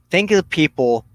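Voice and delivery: ordinary talk, nothing marked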